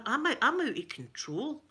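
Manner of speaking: ordinary delivery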